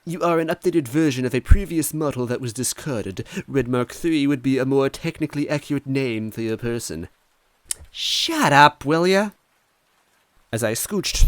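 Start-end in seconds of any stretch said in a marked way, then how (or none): 0.0-7.1 high, precise know-it-all robot; smug android, higher and louder. Acted. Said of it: "Green replied smugly". 7.9-9.3 high, loud irritated snap; loud exasperated retort; annoyed snap. Acted.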